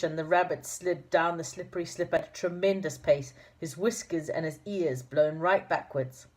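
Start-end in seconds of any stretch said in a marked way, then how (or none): none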